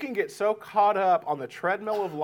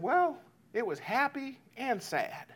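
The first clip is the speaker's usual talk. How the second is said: put-on higher, slower kid's answer; lightly voiced child; 8 semitones higher, 4 dB quieter than usual. Kids answering parent about a movie, lightly voiced child. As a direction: lightly imitate a young child weighing it up.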